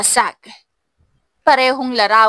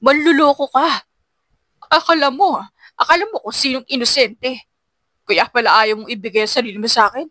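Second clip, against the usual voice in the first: angry accusation. A narrator's reading. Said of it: "Sigaw ni Kyle".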